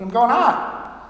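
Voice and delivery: slow Pittsburgh "out", said as "aht"; Pittsburgh accent. Retold or imitated. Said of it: "drop the Pittsburgh accent".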